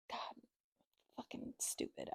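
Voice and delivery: very soft, appalled aside; disgusted whisper. Real, not acted. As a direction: curse under your breath. Disgusted at doctors ignoring mother.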